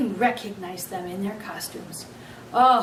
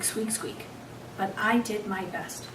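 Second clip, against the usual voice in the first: ordinary delivery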